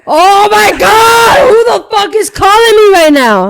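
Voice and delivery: high, loud, slowed yell of disbelief; laughing hammed-up outburst; mock exasperated shout. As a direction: shout it like a call just wrecked the moment. Retelling annoyed outburst at call.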